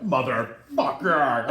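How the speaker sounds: slow, loud, growled-out swear; strained curse. Retold or imitated. Big man climbing over the center console, strained curse. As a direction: drag the curse out with effort and rage.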